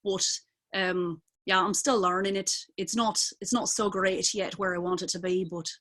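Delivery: learner's Scottish accent, conversational; Scottish accent still being worked on. Shown as a demonstration. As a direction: explain it casually in a practised Scottish accent. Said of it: "it gets into the Scottish accent".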